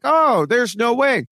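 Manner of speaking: high, drawn-out dismissal; mock disbelief. Housemates refusing to believe Taylor's truth, mock disbelief. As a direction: imitate incredulous housemates, slow and high.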